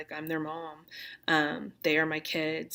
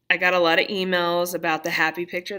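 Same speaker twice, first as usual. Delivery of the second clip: ordinary talk, nothing marked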